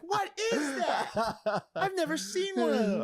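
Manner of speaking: high, slow, dim-witted voice; clueless girlfriend. Retold or imitated. Girlfriend asking about the troll, high baffled voice. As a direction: play her baffled and slow. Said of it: "She's like".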